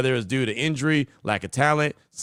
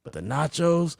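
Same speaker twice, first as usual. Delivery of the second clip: Jerry Jones impression; much slower than usual. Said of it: "But Jerry Jones, I got that".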